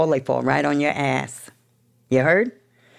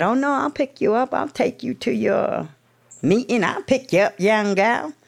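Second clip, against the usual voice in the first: imitated old man; 7 semitones higher than usual. Old man voiced in story.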